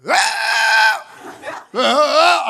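loud, high retold wail; mock cry out. Preacher imitating a shout in church, loud and high. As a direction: cry out like the spirit hit you. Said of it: "he went deeper".

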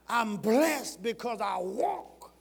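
rising preacher's fervor; building preaching. Real, not acted. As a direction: build the blessing slowly. Sermon climbing to climax.

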